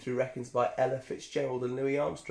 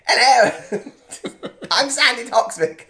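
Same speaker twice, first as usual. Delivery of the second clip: loud, high mock posh lady; Sandy Toksvig impression; 9 semitones higher, 11 dB louder, slower than usual. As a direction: squeal it out as a mock posh presenter. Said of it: "Just sounds like".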